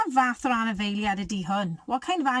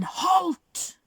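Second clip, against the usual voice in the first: bellowed halt; 9 semitones higher than usual. Acted. The rat bellows at the ants to stop. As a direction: bark it out to stop them. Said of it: "who bellowed a deafening".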